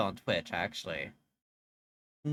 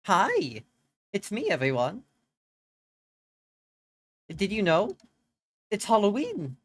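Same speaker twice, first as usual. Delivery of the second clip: excited greeting; 8 semitones higher, 4 dB louder than usual. Real, not acted. Bubbly stream opener greeting chat.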